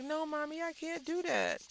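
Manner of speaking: put-on high little-boy voice; a high child's protest. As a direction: play the son protesting sweetly. Son voiced, much higher.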